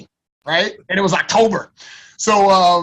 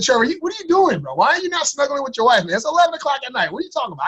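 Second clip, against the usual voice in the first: high, incredulous what-are-you-doing; high-pitched disbelief at a late call; exasperated retort; 8 semitones higher, faster than usual. Retold or imitated. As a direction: push back at your brother, baffled and annoyed. Annoyed late-night call from brother.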